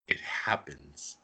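hushed, slow whisper of the big moment; slow awed whisper; dramatic whisper. Acted. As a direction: whisper it like a secret reveal.

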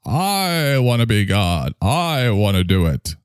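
hammed-up craving for godhood; Lucifer. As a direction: stretch every word like a power-hungry devil. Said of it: "Yeah, Lucifer".

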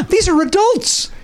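slow high disbelief; incredulous. Real, not acted. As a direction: stretch it out in disbelief. Mock disbelief at bunking.

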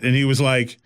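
ordinary delivery

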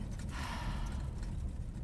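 long breathy sigh; weary exhaled sigh. A narrator's reading. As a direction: let out a long, soft exhale. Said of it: "She sighed".

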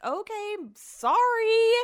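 high, sarcastic sing-song apology; sarcastic high-pitched apology to the boyfriend; mock sorry. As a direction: say sorry way up high, not meaning it.

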